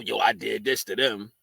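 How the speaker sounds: low-pitched mock boasting; mock braggart's low voice; imitated braggers. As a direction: drop the pitch to mimic men bragging.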